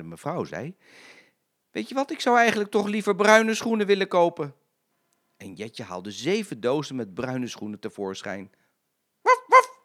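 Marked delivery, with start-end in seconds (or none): 1.7-4.5 high, loud demanding lady; fussy lady customer. A narrator's reading. Lady changing her mind to Jetje, high fussy voice. 9.2-9.8 high, loud yapping barks; yapping little dogs. A narrator's reading. Little dogs yapping at the shoe shop assistant, shrill yapping. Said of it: "keften de hondjes weer".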